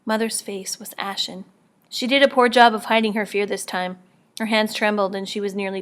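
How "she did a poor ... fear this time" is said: ordinary delivery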